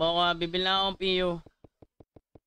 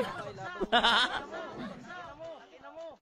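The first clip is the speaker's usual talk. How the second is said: laughing; 8 semitones higher, 6 dB quieter than usual. Real, not acted. Laughing at teammate chat.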